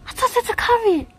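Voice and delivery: high-pitched squeal of disbelief; shocked. Real, not acted. Streamer seeing something happened to the curry, shocked squeal. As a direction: keep it way up high, baffled.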